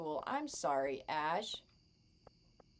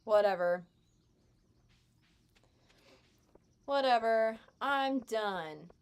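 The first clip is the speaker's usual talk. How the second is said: loud whatever; 3 semitones higher, 5 dB louder, slower than usual. Real, not acted. Dismissive repeated whatever.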